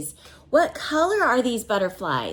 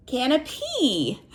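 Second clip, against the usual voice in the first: ordinary delivery, nothing marked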